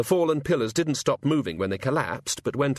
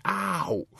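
a sudden higher "ah, ouch" of pain; pained yelp; 9 semitones higher than usual. Acted. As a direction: yelp as the headache stabs. God winces.

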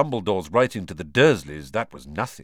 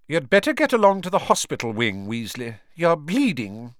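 put-on stern old professor; 6 semitones higher, 4 dB louder than usual. A narrator's reading. McGonagall voiced, stern teacher.

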